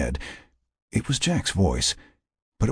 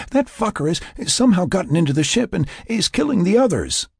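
high, tense rushed thought; panicked inner voice; 11 semitones higher than usual. Acted. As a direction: think it in a frantic panic.

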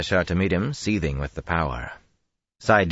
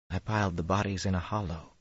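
quiet, toneless telling; flat voice; 4 semitones higher, 7 dB quieter than usual. Acted. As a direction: say it flat, hiding the pain. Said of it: "he said in a flat voice".

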